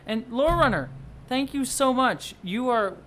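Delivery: high, loud, drawn-out gratitude; effusive thanks. Real, not acted. Streamer thanking a generous viewer, effusively. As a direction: gush with thanks, stretching it out.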